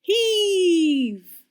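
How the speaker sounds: very high, loud shout; shouted ready. A narrator's reading. Percy shouting to the rescuers lined up behind him. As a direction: call out to the team. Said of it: "shouted Percy".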